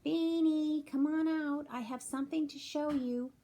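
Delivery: high, loud call from outside. A narrator's reading. Carol Ann calling Beanie from outside, loud and high. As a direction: shout for Beanie to come out. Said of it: "Carol Ann outside yelling".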